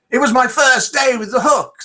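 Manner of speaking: hammed-up pirate that misses the accent; a pirate in a joke, voiced half-heartedly high; failed pirate accent. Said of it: "my pirate accent".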